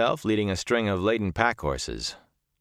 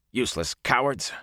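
contemptuous growl, pitched up; 5 semitones higher than usual. A narrator's reading. Soldier scorns the tinkers, growling. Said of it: "Masema growled".

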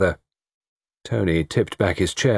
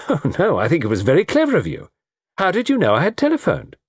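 lively, higher-pitched society woman; amused young woman; 10 semitones higher, faster than usual. Acted. Pauline answering the detective who caught her out, amused and lively. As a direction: toss it back with a laugh, pitch swooping.

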